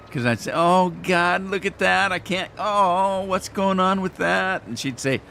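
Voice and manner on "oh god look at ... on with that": high whiny complaining voice, drawn out; whining. Retold or imitated. Imitating his own whining to Lori, high and whiny. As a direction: whine like a fussy complainer, pitched up. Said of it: "said I was whining all the time".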